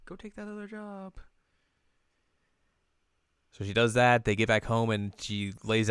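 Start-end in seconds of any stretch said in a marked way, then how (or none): 0.0-1.1 very soft, high character voice; gentle urging. Retold or imitated. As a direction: urge softly in a tiny voice. Acts Hanamaru urging her to work.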